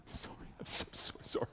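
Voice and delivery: whispered, sheepish Canadian apology; mumble-whisper a meek Canadian sorry; barely audible Canadian apologizing; apologetic Canadian. Acted. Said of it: "you do the Canadian".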